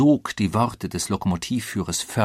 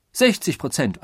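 higher, excited interjection; eager outbidding; 5 semitones higher, 4 dB louder than usual. A narrator's reading. Another passenger raising the odds, eager. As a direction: top the last bid eagerly.

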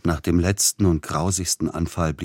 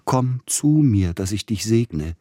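high, loud, drawn-out call; rapturous call; 6 semitones higher, 5 dB louder, slower than usual. A narrator's reading. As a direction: call out in ecstasy, stretching it. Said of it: "rief der leidende Verzückte". (verzückter, lauter, gedehnter Ruf)

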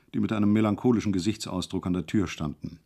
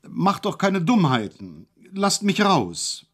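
higher, slow call; 8 semitones higher, 3 dB louder, slower than usual. Acted. Hejula calls out from isolation, higher and slow. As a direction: plead loudly to be let out. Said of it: "Bald aber rief er".

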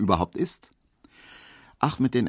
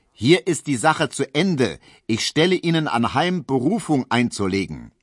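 loud dismissal; 5 semitones higher, 4 dB louder than usual. A narrator's reading. Judge still bellowing dismissal.

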